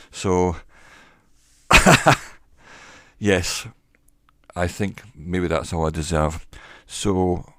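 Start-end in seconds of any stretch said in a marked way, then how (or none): 1.7-2.2 loud, high laugh; sudden sharp burst of real laughter. Real, not acted. Amused at own cheap microphone.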